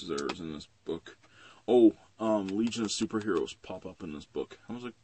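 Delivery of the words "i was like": ordinary delivery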